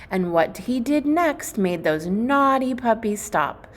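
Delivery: ordinary delivery, nothing marked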